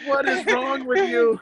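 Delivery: a hint of a grossed-out bystander; quoted disgusted guy. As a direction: toss off a baffled "what is wrong with you". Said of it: "And the guy's like".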